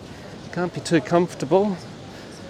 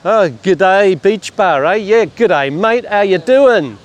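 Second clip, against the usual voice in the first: loud, higher-pitched hearty greeting; calling out; 4 semitones higher, 7 dB louder than usual. Real, not acted. Vlogger returns the beach bar's g'day, calling out. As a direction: call back a greeting across the distance.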